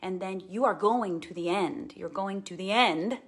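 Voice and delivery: normal talking voice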